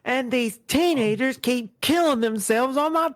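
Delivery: high, whiny mock complaint; exasperated bystander.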